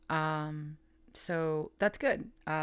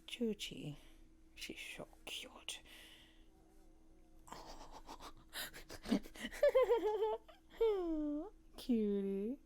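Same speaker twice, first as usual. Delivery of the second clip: cooing whisper to cat; 6 semitones higher, 6 dB quieter, slower than usual. Real, not acted.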